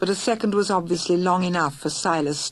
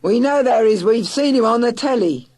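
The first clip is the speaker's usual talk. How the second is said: loud, higher retort; shouting back; 5 semitones higher, 7 dB louder than usual. Acted. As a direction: shout the contradiction. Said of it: "shouted Murgatroyd".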